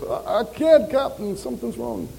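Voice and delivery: rough attempt at a Scottish voice; Scottish accent. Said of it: "in the Scottish voice".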